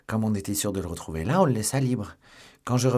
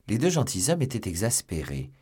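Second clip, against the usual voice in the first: usual speaking voice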